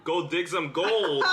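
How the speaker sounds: slow Wild West cowboy drawl; cowboy accent. Acted. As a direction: put on an old-time cowboy accent. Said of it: "This is a real original cowboy accent".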